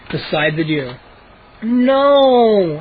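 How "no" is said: moaning. A narrator's reading. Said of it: "I moaned".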